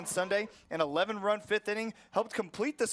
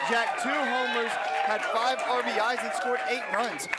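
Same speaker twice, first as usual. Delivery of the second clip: excited stat rundown; 2 semitones higher, 4 dB louder than usual. Real, not acted. Hyping her MVP's weekend.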